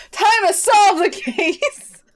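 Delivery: high, loud, slow mock-dramatic call; hammed-up detective announcement. Player in deerstalker opening the case, hammed-up. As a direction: stretch it out with showy gusto.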